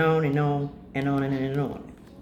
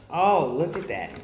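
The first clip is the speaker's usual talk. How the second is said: ordinary delivery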